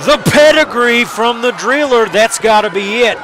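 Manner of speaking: live wrestling play-by-play, rising to shouts at the finish; wrestling commentator. Acted. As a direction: call the match as it happens.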